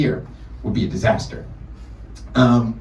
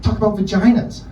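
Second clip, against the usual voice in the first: mom voice; 9 semitones higher, 4 dB louder than usual. Retold or imitated. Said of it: "She was like".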